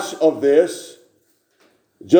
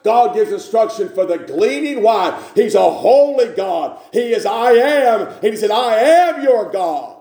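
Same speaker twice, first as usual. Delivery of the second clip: higher, fervent preaching voice; 5 semitones higher than usual. Acted. Preacher proclaiming God's word to congregation, fervent preaching. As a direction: preach it out with conviction.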